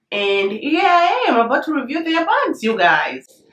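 high, swooping delighted announcement; excited. Real, not acted. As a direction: celebrate it in a high, singsong lilt. Celebrates getting to review the bags.

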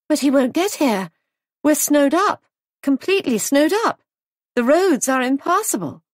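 high, loud and worried; anxious protest. Acted. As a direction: plead that they're cut off by snow. Molly protests on the phone.

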